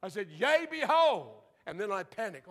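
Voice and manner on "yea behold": high, loud and slow; slow loud proclamation. Retold or imitated. Teen repeating his prophetic opening to the hushed church, loud and slow. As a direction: stretch out a bold prophecy. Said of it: "no one had ever seen me that vocal before".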